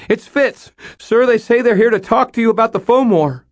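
scared apprentice shouting for help; high rushed frantic yell. A narrator's reading. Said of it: "he called out frantically".

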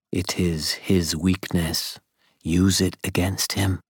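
lightly voiced, slow, deliberate counsel; a hint of Mab, measured advice; Mab advising. A narrator's reading. Mab advising Dresden on his grandfather, slow and lightly voiced. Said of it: "If Mab had been standing there advising me".

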